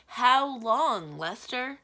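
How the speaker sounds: acted despair, crying out to a servant; desperate cry. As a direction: call it out in despair, high and loud. Wizard despairs to his servant.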